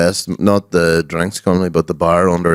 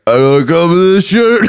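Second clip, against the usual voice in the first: higher, louder mock of the shirtless singer; 9 semitones higher, 3 dB louder than usual. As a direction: ham up the singer's boast. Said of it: "you, like".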